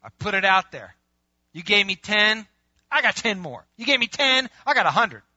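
loud, high, eager boasting; proud report. Acted. Servant showing the master his profit, proud and eager. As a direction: pile up the gains with rising pride.

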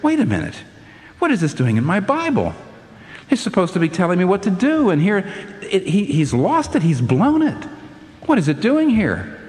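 high, indignant disbelief; baffled protest. Retold or imitated. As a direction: object like a puzzled reader. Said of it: "I used to say".

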